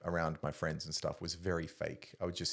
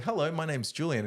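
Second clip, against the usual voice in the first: higher-pitched, self-mocking introduction; awkward greeting; 9 semitones higher, 4 dB louder than usual. Sitting down uninvited at a sorority table, awkward greeting. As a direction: act out an awkward, uninvited hello. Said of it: "I would so awkwardly".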